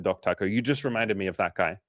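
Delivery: ordinary talk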